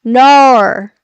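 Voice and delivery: loud "Nar" with an r-coloured ending; "Nar", vowel twisted toward "nor"; Australian-style "Nar". Shown as a demonstration. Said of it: "They say, like".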